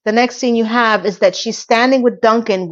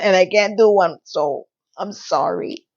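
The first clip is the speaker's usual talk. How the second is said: usual speaking voice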